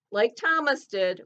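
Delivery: normal talking voice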